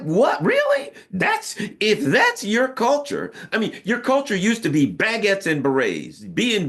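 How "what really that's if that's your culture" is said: high, incredulous mock gasp of disbelief; mock aghast viewer reacting to the Paris Olympics intro. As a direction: act shocked at the spectacle, hamming it up. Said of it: "You're like".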